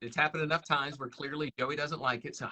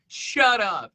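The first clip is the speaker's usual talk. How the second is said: joking shut up; 6 semitones higher, 9 dB louder than usual. Retold or imitated.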